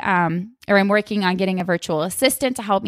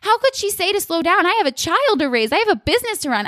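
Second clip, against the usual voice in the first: high-pitched mock indignation, pitch way up; indignant outburst; 8 semitones higher than usual. Mocking her past self's reply to mother-in-law, high indignant whine. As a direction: whine it like an offended victim. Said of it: "I wasn't actually mad at her, but I was like".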